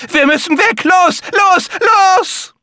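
high, loud panicked yell; bellowed order. Acted. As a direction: scream at the children to run. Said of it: "brüllte Maria".

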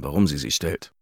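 plain reading voice

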